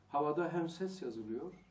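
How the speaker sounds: booming sermon emphasis; emphatic preaching. Real, not acted. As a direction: drive the point home from the pulpit.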